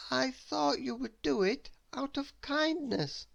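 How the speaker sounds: a mild hint of hurt surprise; gentle surprise. Mister Worry to Mister Mean, lightly voiced, gently surprised. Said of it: "he said".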